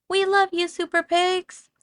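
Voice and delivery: high, loud adoring cheer; cheering crowd. A narrator's reading. Townsfolk cheering their hero pigs, high and loud. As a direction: cheer them on like a grateful crowd.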